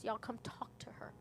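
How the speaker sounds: a retold whisper, breathy and unvoiced; hushed urging. Narrator whispering to family to come talk to her. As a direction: whisper it so the dying mother is not disturbed.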